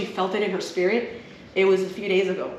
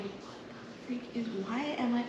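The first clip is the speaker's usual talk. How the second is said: whispered confusion; 3 semitones higher, 13 dB quieter than usual. Retold or imitated. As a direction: whisper it to yourself, bewildered. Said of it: "I'm like".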